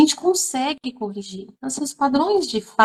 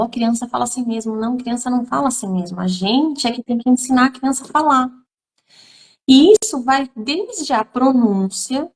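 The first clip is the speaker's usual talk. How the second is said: usual speaking voice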